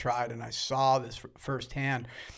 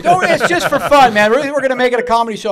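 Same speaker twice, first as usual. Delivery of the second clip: much higher and louder, fast and breezy; loud retold friend; 9 semitones higher, 14 dB louder, faster than usual. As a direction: wave it off loudly like an excited buddy. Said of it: "Stout told me".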